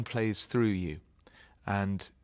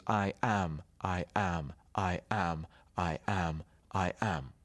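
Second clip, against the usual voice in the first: repeated "I am", each am hit harder than I; iambic beat; 3 semitones lower, slower than usual. Shown as a demonstration. Actor beating out the pentameter line, stressed am. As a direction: tap out the da-DUM, stressing every am. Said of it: "it goes".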